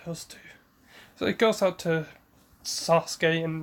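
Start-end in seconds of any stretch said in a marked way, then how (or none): 0.1-0.4 soft, resigned under the breath; weary mutter. Retold or imitated. Kakashi sighing over Naruto and Sasuke, soft resigned mutter. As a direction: mutter it, worn out and fond. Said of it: "and it's like".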